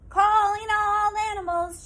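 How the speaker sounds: put-on old woman calling out in a singsong; shouted call. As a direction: call across the meadow again to every animal. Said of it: "shouted the old woman".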